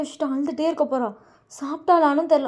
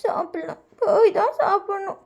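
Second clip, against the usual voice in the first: high, slow, breaking into acted tears; crying Vayan; 6 semitones higher than usual. A narrator's reading. Sister telling uncle she hasn't eaten, acted crying. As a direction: whimper the answer, drawn out. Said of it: "ஏன் வயன் அழுவுற".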